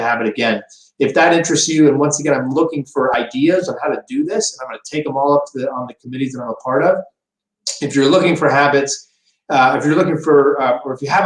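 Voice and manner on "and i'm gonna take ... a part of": rushing through the words; rattling off at speed; talking too fast. Real, not acted. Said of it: "talking too fast".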